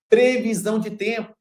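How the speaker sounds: ordinary talk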